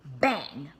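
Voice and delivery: a breathy whispered bang. Acted. Reader makes the bang sound in a whisper.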